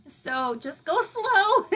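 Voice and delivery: high, very slow words through laughter; laughing. Real, not acted. Host laughingly advising a dizzy viewer, slow giggly. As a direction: giggle while giving the advice.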